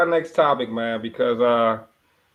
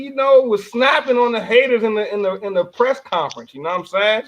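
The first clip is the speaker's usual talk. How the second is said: ordinary talk, nothing marked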